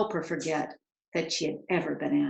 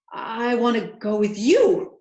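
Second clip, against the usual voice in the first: slow, louder eager blurt; 4 semitones higher, 6 dB louder than usual. A narrator's reading. Girl blurting her choice to the manservant, eager and loud. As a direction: let it burst out of her at last. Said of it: "Orphalina blurted out".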